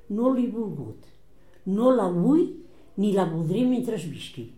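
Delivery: defiant retort. Retold or imitated. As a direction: hammer home that she never will. Mother's defiant retold reply.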